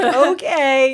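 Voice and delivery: high, loud, drawn-out mock okay; exaggerated retold surrender; helpless giving-in. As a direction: imitate caving in, voice lifted. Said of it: "you can't say no".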